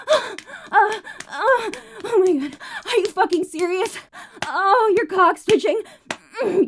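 performed moaning with gasps and cries; breathy moaning. Acted. Streamer performing for her chat, moaning throughout. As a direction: moan through every line, pleasure building.

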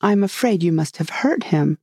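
plain reading voice

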